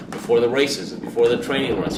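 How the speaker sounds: ordinary delivery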